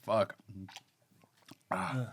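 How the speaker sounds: strained, fading words, throat on fire; burning throat from spicy food. Real, not acted. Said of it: "now it's getting deep in my throat".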